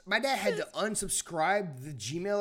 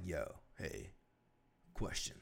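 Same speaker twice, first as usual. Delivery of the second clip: lightly voiced dad, low and soft; a hint of a puzzled father asking; quoting dad; 15 dB quieter, slower than usual. Son imitates his dad asking a question, low and hushed. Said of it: "my dad was like".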